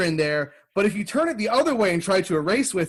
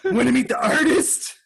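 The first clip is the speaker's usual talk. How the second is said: high, slow, laughing offer; laughing mock invitation; 8 semitones higher, 3 dB louder than usual. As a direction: invite them to meet the navel. Acting out the artist pitch.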